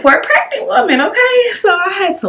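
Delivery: tearful. Real, not acted. Recalling her emotional breakdown.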